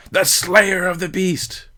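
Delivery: high, drawn-out acclaiming yell. A narrator's reading. Someone at the back hails the hero, yelling out. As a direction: call it slowly from the back. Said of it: "someone in the back yelled out".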